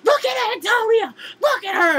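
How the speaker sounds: high, loud excited call of a friend showing her off. Retold or imitated. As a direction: shout it out high and loud to get the crowd to look. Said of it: "and he goes".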